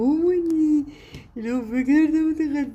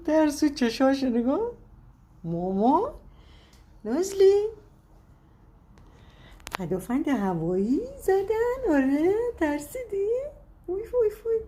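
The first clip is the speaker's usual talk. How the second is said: cooing pet talk; 8 semitones higher than usual. Real, not acted. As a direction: coax a frightened little one gently. Coos to scared pet.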